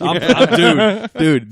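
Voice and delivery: can't contain his excitement; real excitement, high and loud; excited. Real, not acted. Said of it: "He's so excited".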